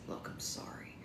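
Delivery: hushed, almost whispered apology; quiet confiding aside. A narrator's reading. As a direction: drop to a near whisper. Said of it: "Mikey lowered the tone of his voice".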